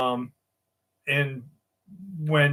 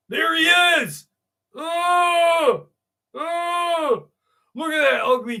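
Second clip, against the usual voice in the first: excited shout; 12 semitones higher, slower than usual. Real, not acted. Streamer spotting the creepy old guy, excited shout. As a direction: shout out as he pops up.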